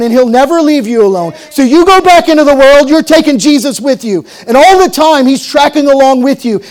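loud, rising preacher's cry; full-force preaching. Acted. Preacher urging his listeners, preaching at full force. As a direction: drive it home to the congregation.

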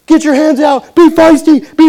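high, loud re-enacted sideline yell. Coach yelling to players from the bench, loud shout. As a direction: bellow orders out to the court. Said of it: "we're yelling from the bench".

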